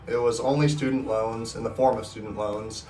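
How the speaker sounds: ordinary talk, nothing marked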